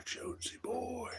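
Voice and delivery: a sly whispered chant; whispering. Real, not acted. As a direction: whisper the name over and over. Whispered repeats of name.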